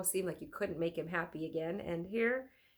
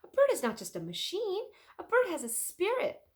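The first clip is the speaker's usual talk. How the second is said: passionate; 5 semitones higher, 6 dB louder than usual. Acted. Leonardo's passionate explanation.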